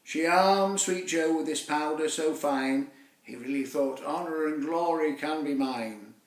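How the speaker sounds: ordinary delivery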